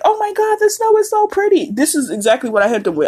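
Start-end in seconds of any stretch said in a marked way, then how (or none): 0.0-1.7 much higher, gushing and starry-eyed; mock a person swooning over snow; high mock gush about pretty snow; gushing snow fan. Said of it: "when people be like".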